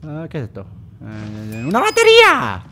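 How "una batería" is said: loud, very high cry of delight; excited exclamation. Real, not acted. Player finding a battery, high excited shout. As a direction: shout it out high, thrilled at the find.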